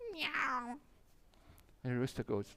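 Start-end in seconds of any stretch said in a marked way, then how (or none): 0.1-0.8 high, soft mock meow; cat meow. Cat shows the chick its noise, high little meow. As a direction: play the cat's meow for the kids. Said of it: "what noise does cats make".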